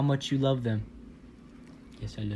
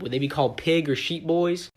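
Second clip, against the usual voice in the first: usual speaking voice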